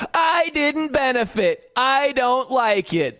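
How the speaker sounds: a hammed-up whiny complaint; mock whining. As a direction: mimic a pouting driver who lost out. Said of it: "a bunch of biased whining".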